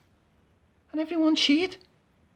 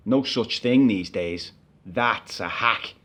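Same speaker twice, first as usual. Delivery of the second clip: low, slow driver's voice; gruff bus driver; 9 semitones lower, much slower than usual. Retold or imitated. Passenger retelling the driver refusing him, low and slow. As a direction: drop low and drag it out like a stern driver.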